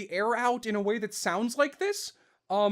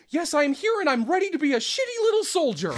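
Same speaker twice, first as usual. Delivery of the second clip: higher, eager little soldier voice; high, proud young angel reporting in; Pit's voice; 10 semitones higher, 4 dB louder than usual. Shown as a demonstration. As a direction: chest out, ready for duty. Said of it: "I'm standing with my chest out".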